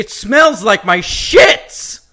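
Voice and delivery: loud, hammed-up Ace Ventura bravado; Ace Ventura impression. Retelling Shrek's opening to a friend, loud Ace Ventura voice. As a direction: belt it out like a cocky pet detective. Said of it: "doing an old Ace Ventura".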